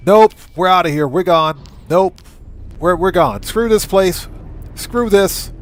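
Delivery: ordinary talk, nothing marked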